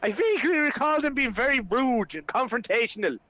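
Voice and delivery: high-pitched indignant complaint; Flynn, indignant. Acted. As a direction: argue back, put out. Flynn argues back.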